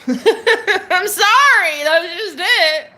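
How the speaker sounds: high, drawn-out laughing sorry; laughing apology. Real, not acted. Apologizing to viewers while cracking up.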